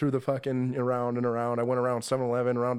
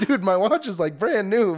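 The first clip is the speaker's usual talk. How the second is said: higher, louder offended retort; indignant protest; 6 semitones higher, 5 dB louder than usual. Retold or imitated. Storyteller replaying his own indignant retort to the homeless guy, loud and high. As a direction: protest loudly like a wounded ego.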